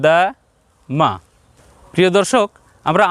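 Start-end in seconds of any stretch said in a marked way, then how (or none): 0.1-1.1 দা, মা each kept short; short vowels. Shown as a demonstration. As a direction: cut the vowels brief in দা and মা. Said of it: "পরের দুইটাতে সংক্ষেপ করব".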